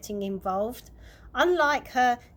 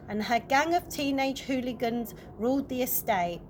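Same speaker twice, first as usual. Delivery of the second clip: usual speaking voice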